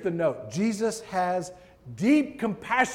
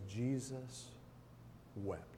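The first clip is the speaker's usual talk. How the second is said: hushed solemn reading; 5 semitones lower, 15 dB quieter, slower than usual. Acted. Solemn reading of Jesus wept.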